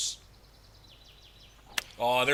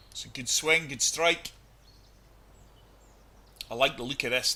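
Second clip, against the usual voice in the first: ordinary talk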